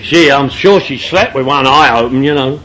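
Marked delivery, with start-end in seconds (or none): none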